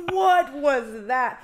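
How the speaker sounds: very slow, loud, high mock astonishment; imitated group astonishment. Retelling the whole group's reaction to the voice, mock astonishment. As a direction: stretch each word out, imitating a stunned crowd. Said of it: "everyone was like".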